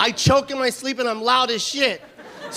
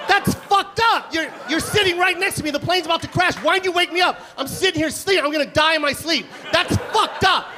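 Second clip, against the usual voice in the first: outraged rant; 4 semitones higher than usual. Retold or imitated. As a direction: scold her for not waking him. Angry rant at seatmate.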